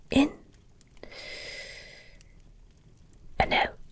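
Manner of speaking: soft, calming breath coaching; soothing reassurance. Acted. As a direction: talk them gently down from panic. Calming listener, guiding their breathing.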